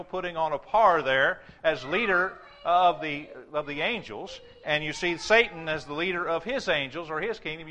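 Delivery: ordinary talk, nothing marked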